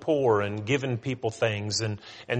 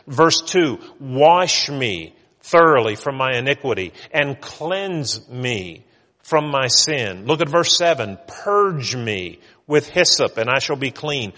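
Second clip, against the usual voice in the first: firm, louder reading of the psalm, verse by verse; preacher reading Psalm 51 aloud to the church; scripture reading; 6 dB louder than usual. Acted. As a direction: proclaim each verse to the congregation.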